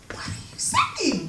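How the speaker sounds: high little starlet voice, laughing through it; Marilyn Monroe voice. Retold or imitated. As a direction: imitate a tiny, high-pitched voice, half laughing. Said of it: "Marilyn Monroe-like voice".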